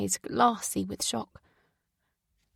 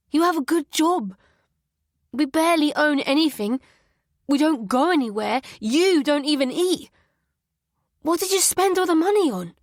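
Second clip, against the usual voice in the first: high, loud demanding questions; accusing outburst; 6 semitones higher, 5 dB louder than usual. Acted. Son confronting his mother about debts, angry. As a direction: confront her, getting angrier.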